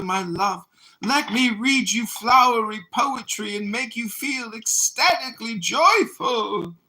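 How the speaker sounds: high, dramatic, gushing delivery; theatrical voice. Acted. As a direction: lay it on thick like a stage actor. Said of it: "It's a theatrical voice".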